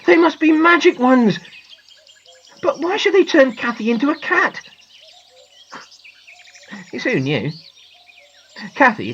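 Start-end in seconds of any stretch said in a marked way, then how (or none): 0.0-4.6 put-on high, dismayed boy; groaning. A narrator's reading. Poor boy puzzling over the magic, groaning. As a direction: puzzle aloud in high-pitched distress. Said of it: "groaned poor John".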